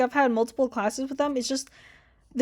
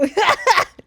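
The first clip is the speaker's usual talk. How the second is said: laugh; 9 semitones higher, 6 dB louder than usual. Real, not acted. Laughs at own joke.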